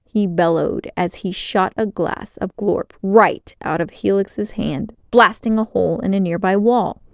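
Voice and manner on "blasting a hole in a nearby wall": ordinary delivery, nothing marked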